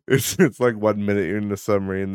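usual speaking voice

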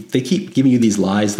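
ordinary talk, nothing marked

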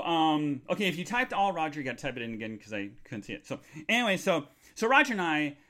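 ordinary delivery

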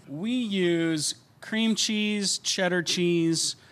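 usual speaking voice

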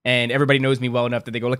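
ordinary delivery

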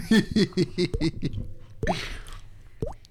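breathy laugh. Real, not acted. Laughing at ASMR joke.

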